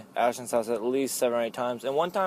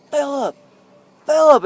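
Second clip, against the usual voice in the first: much higher, loud searching call; 11 semitones higher, 8 dB louder than usual. Retold or imitated. Searching the dark neighborhood for a lost friend, loud high calls. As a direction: shout his name down the street.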